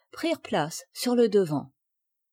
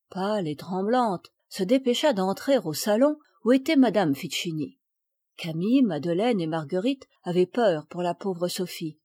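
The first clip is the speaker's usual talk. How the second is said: ordinary delivery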